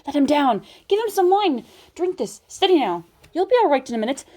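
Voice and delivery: put-on kindly urgent calls to the boy; rescuers calling. A narrator's reading. Rescuers reviving Edmund, calling out.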